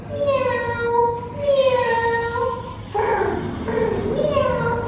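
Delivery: high, drawn-out imitated meows; cat meowing. Shown as a demonstration. Teacher giving children a pet clue, high soft meowing. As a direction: mew softly up high like a cat. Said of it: "They sound like this".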